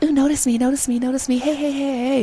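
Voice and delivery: high-pitched mock pleading for attention; high, repetitive mock flirting; eager, flirty imitation.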